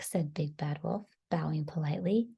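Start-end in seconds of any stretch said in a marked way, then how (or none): none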